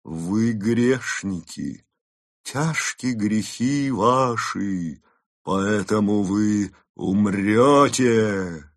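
very slow, otherworldly grave voice; sepulchral voice. Acted. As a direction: intone like a voice from the tomb. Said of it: "произнёс замогильным голосом".

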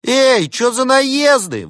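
very high, loud, drawn-out protest; affronted jackal pilot at full pitch; indignant squawk. A narrator's reading. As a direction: object in a squeaky outraged voice. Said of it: "возмутился пилот".

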